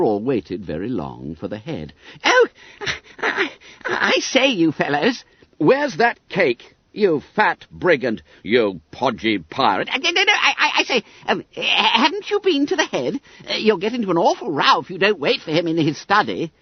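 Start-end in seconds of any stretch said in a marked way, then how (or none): 2.2-5.2 very high, loud squeak of alarm; flustered schoolboy Bunter. Acted. Bunter caught at the cupboard. 5.6-9.9 high, slow, punched accusation; angry demand. Acted. Angry boys confront cake thief. 9.9-16.5 very high, stammering excuse; flustered schoolboy Bunter. Acted.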